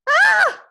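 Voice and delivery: shrill terrified scream; high scream. Acted. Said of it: "his mother screamed".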